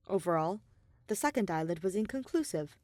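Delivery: usual speaking voice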